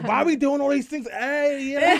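loud, high imitation of a fired-up friend; mock emphatic outburst. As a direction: tease by overdoing someone's angry emphasis. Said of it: "That's how I emphasize".

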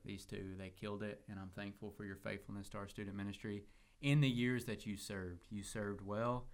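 quietly sincere praise for faithful service; serious, sincere. Real, not acted. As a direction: say it softly and mean it. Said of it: "I am serious".

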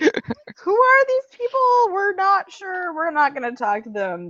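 high, drawn-out mock bewilderment; bewildered crowd. Voicing confused fans eyeing the chanters, high mock-wary voice.

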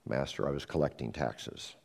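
low, soft, ashamed confession. Acted. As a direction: drop your voice in shame. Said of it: "lowers his voice".

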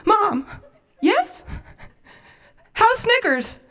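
high, tense acted questions; anxious phone dialogue. Daughter asks mom about the dog, high and anxious. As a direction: ask mom with mock urgency.

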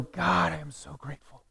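very soft, grateful prayer; hushed worship. Acted. Preacher acting a grateful worshipper speaking to God, hushed. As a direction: drop to a near-hush, reverently. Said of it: "just a moment of worship".

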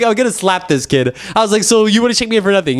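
high-pitched, worked-up retelling; indignant disbelief. Retold or imitated. Patient fuming at being checked in for nothing, indignant. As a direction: keep the pitch up, comically outraged. Still fuming in retold argument.

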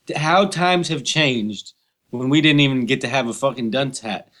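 ordinary delivery

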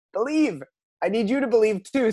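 earnest high-pitched urging. Retold or imitated. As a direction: lift it high, urging him on. Jesus urges the father.

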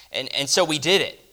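ordinary talk

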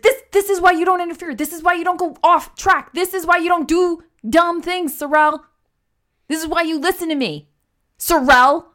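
angry high-pitched tirade; Marin. Acted. Marin lectures Sorrel for going off track, angrily. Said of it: "get angrier and angrier".

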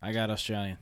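a quick Australian twang on the words; lightly Australian; Australian accent. Retold or imitated. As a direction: slip into Australian mid-sentence. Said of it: "He turned Australian while saying Australian".

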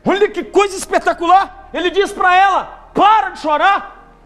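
high, loud exultant preaching; preaching climax. Acted. Preacher exulting to the congregation, high and loud. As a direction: soar into the climax.

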